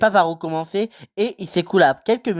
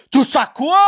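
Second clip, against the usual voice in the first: booming, pitched much higher and louder; thundering; 10 semitones higher, 5 dB louder than usual. Acted. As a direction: thunder Tout ça quoi back at him. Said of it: "tonna Hagrid".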